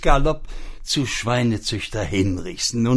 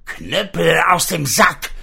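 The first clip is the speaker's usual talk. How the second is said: louder higher magic command; shouted spell; 6 semitones higher, 6 dB louder than usual. Acted. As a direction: yell the magic words. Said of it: "schrie da das arme Schwein".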